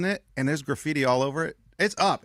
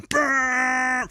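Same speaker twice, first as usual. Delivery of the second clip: long, high horn blare by mouth; imitated truck horn; 10 semitones higher than usual. Telling friends how the truck lays on the horn, imitated honk. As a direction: blast out a mock horn honk. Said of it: "the truck just lays the horn".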